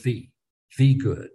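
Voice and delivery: "the" said as a stressed "thee" before good; "the" singled out with a long ee; emphatic article. Shown as a demonstration. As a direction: lean hard on "the", pronounced THEE. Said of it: "it's putting an emphasis on that word good".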